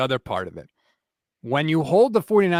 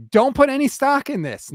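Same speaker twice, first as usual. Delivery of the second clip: raised, insistent warning; emphatic warning; 10 semitones higher, 5 dB louder than usual. Real, not acted. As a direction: warn them off firmly. Host warns listeners about injury reports.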